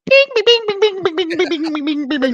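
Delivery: rapid, very high-pitched "bing bing bing"; imitated hormone buzz. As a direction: squeal out bings like hormones going haywire. Imitating racing teenage hormones.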